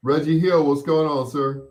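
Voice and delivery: high, loud call-out greeting a listener by name; on-air greeting. Real, not acted. Host greeting a caller.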